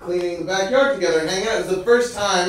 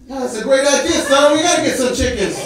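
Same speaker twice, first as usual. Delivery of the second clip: higher, louder burst of enthusiasm; excited agreement; 8 semitones higher, 6 dB louder than usual. Retold or imitated. Neighbour hearing the chicken idea, excited agreement. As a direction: jump on the idea eagerly.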